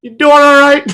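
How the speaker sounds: high, loud, exaggerated caring check-in; concerned friend checking in. Friend calling to check on him, high mock concern. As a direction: ham up a worried friend's phone call. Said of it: "someone needs to call me and go".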